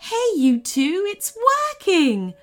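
bright, high, eager greeting; beaming excitement. Acted. Bev greets the kids at the door, thrilled. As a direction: burst in with happy news. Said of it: "a big grin on her face".

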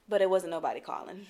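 ordinary talk, nothing marked

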